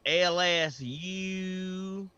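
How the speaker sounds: mocking put-on Southern drawl; fake Southern accent. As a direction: drag each letter out in a hammed-up Southern twang. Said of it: "why is he faking the Southern accent".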